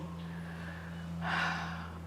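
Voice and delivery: long, soft breathy exhale; releasing sigh. Real, not acted. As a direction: let out a long, voiceless sigh. Exhale while speaking of releasing.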